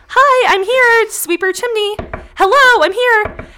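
high, loud, frantic calls at the door; frantic sweep calling out. Retold or imitated. Storyteller voicing chimney sweeps crowding the doorstep, high frantic shouting. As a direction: shout it like a desperate tradesman hammering for attention.

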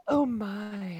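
high, gasping, fake scared voice; mocking frightened man. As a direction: play him scared and fussy. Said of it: "he's like".

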